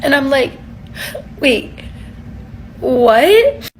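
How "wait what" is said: surprised. Retold or imitated. Shocked at surprise cash offer.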